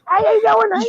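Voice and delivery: very high squeaky chipmunk. Retold or imitated. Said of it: "you hear a chipmunk talking".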